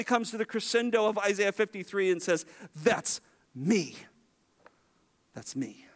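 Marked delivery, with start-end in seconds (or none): 2.7-4.0 low, weighty declaration; Jesus declaring himself. Retold or imitated. Preacher voicing Jesus's reveal, low and weighty. 5.3-5.8 low, hushed repeat; hushed echo. Retold or imitated. Preacher echoing Jesus's reveal in a soft hush.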